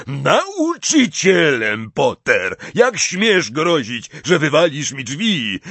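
furious bellow, high and loud; slow, booming outraged roar. A narrator's reading. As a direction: thunder at a cheeky pupil. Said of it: "ryknął".

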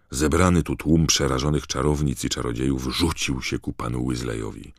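ordinary delivery

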